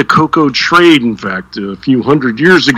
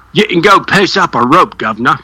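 put-on a light British accent, a touch higher; 5 semitones higher than usual. Sign-off to the Royals in a light British accent. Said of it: "you have a really good British accent".

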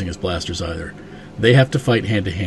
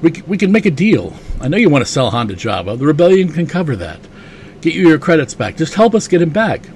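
higher-pitched, pleading pitch; eager bargaining; 7 semitones higher, 3 dB louder than usual. Retold or imitated. Lando bargaining with Boba Fett, a higher eager pitch. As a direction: sell the deal hard. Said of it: "And Lando says".

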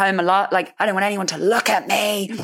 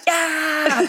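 mock burst of energy; 11 semitones higher than usual. Sudden burst of energy onstage.

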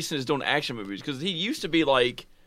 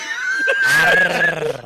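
shrieking laugh; 5 dB louder than usual. Real, not acted. Friends cracking up.

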